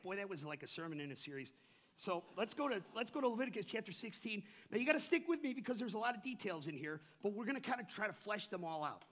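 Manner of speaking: ordinary talk, nothing marked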